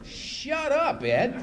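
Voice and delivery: high, strained retort; acted irritated outburst; exasperated. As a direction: cut off the chatterer in mock fury.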